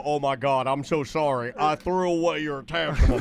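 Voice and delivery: low-pitched mock confession; imagined apology. Host imagines the husband confessing to his wife, mock apology. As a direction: own up in a hushed-pitch, pretend apology. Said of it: "did you stand there and you go".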